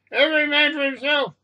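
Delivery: high, panicked call to flee; panicked cry. A narrator's reading. Passenger urging everyone to flee, panicked cry.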